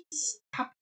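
breathy whisper. Real, not acted. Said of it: "Will you stop whispering?".

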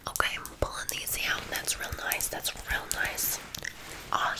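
soft, breath-only whisper; ASMR whisper. Acted. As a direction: whisper it close to the client. Whispered ASMR salon roleplay.

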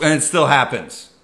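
ordinary talk, nothing marked

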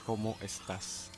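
¿Cómo estás? said softly, falling; gentle ¿Cómo estás?; soft Spanish demo. Shown as a demonstration. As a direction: quietly model ¿Cómo estás?. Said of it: "And you answer".

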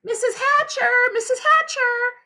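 very high, eager sing-out; girl calling out. A narrator's reading. Sheila calls to Peter's mother, very high eager call. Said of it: "Sheila called".

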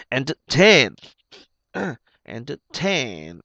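"Entertain" stretched on the last syllable, "tayn"; ay diphthong. Shown as a demonstration. Teacher showing students the A sound, stretched final diphthong. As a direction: model "Entertain" slowly with a drawn-out ay. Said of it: "âm A ha".

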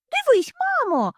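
high shrill squeal. Acted. Little hare squeals to its mother about stripes. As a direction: squeal to mom in alarm. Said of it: "заверещало зайченятко".

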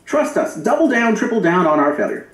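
mocking politicians. Commentator mocking politicians who back Prop one, sneering high voice. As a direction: parody the politicians' smug pitch.

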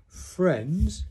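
high, loud, drawn-out address; impressive speech opening. A narrator's reading. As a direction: open a grand speech, projecting to the room. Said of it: "coughed in an impressive way and began to speak".

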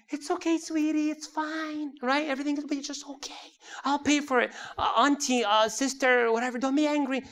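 nice, reassuring bystanders. Acted. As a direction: coo it as if soothing an upset child. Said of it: "though everyone is nice".

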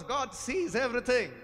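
normal talking voice

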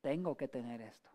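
low, soft statement of need; understated demand. Acted. Person turning a desire into a demand, understated insistence. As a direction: say it low and hushed, as a settled must. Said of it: "te comienzas a comportar ya demandante".

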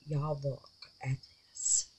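awed whisper. Real, not acted. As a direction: hush and marvel. Whispering in awe at jar.

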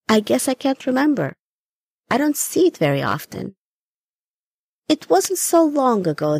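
lightly voiced old grandmother reminiscing; grandmother, gentle and wandering. Grandmother recalls her walk to the turtle pond, wistfully. Gran's voice in the story.

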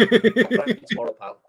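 high, loud plea; hammed-up pleading. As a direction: beg him not to snub you. Manager pleading loudly.

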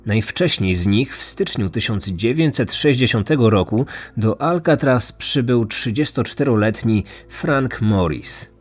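plain reading voice

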